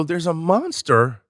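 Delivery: high, incredulous outcry; acted alarm. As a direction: play up the shock at a huge sight. Retells his alarm at moose.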